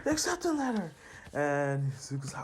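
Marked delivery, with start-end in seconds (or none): 0.0-0.9 very high, squealing mother voice; excited mom. Retold or imitated. Mom announcing the acceptance letter, excited high call. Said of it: "his mom's like".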